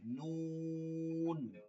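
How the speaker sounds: very slow, nasal-held নুন; নুন stretched long; drawn-out nun. Shown as a demonstration. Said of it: "নুন আপনি যে কোনো ন বাংলায় পড়তে পারেন". (নুন টেনে লম্বা উচ্চারণ)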